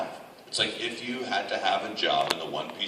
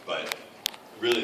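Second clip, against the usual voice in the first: ordinary delivery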